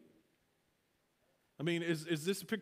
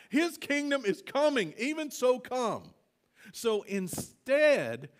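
louder, slowed, building proclamation; preaching at full force; 2 semitones higher, 4 dB louder, slower than usual. Acted. Preacher urging the church to seek the kingdom, louder.

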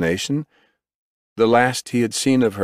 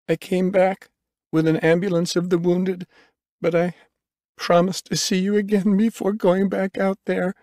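much higher woman's voice; female medic; 11 semitones higher than usual. A narrator's reading. Medic explaining her return to the patient, pitched high. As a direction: voice the medic well above your own pitch.

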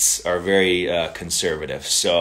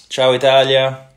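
higher, louder cheer to Italy; excited greeting; 5 semitones higher, 8 dB louder than usual. Real, not acted. To Italian fans on his live stream, a joyful hail. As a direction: call out ciao to home fans joyfully.

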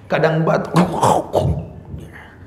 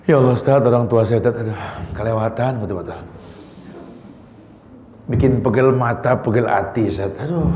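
low, weary imitation of someone venting; mock lament, dropped well down in pitch; complaining congregant; 5 semitones lower than usual. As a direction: act out a worn-down child confiding in the preacher. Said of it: "curhat sama ustadz itu kalau ngomong".

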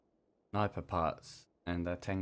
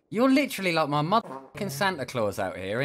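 higher, louder delighted greeting; excited; 6 semitones higher, 5 dB louder than usual. Real, not acted. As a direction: gush over the gift. Thrilled at a gift from stranger.

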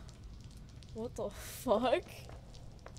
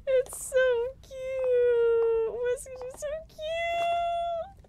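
much higher, slow, near tears; tearful squeal; 13 semitones higher, 6 dB louder, much slower than usual. Real, not acted. Streamer coos at the cat, tearful squeal. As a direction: gush over the cat, voice cracking.

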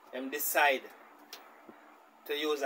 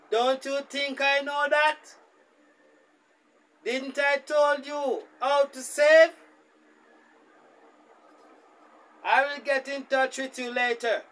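louder, higher, slower scolding dad; irritated father; 9 semitones higher, 5 dB louder, slower than usual. Retold or imitated. Father scolding daughter over money, irritated and louder. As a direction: tell her off like a fed-up father.

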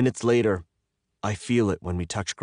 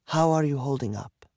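higher-pitched voice for the woman; captor's breezy check-in; 6 semitones higher than usual. A narrator's reading. Captor asking her bound prisoner how he is, breezy. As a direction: lift the pitch, casually checking on the captive.

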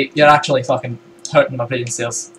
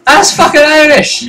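put-on Scottish accent; 7 semitones higher than usual. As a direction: scold indignantly in a Scottish accent. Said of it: "your Scottish accent just".

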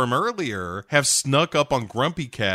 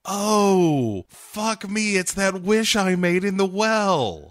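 high, put-on girl voice; overacted dawning dismay; Crystal; 8 semitones higher, slower than usual. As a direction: drag out the realisation high and hammed-up. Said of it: "Crystal is immediately like".

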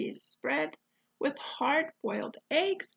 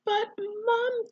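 higher, anxious gasp of protest; 8 semitones higher, 5 dB louder than usual. Acted. Eddie calling after his departing mum, gasped. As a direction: gasp it out in alarm. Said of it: "gasped Eddie".

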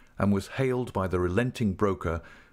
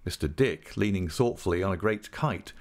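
plain reading voice

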